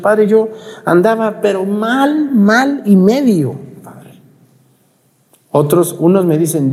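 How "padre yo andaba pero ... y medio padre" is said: slow, high mock confession; imitating a parishioner owning up, slow and high; confessing parishioner. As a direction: drag it out like a repentant sinner. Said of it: "A mí me han dicho".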